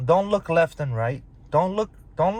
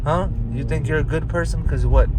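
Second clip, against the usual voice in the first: normal talking voice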